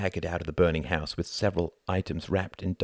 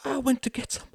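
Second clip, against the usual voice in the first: shaken wife. Acted. Catherine answering, shaken.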